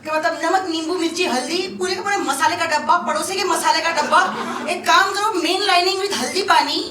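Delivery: rapid, rising comic rant; तेज़, जोशीली शिकायत; excited rant. Real, not acted. Ranting list of home remedies.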